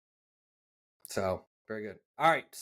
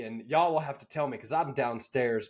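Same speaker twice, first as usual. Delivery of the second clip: usual speaking voice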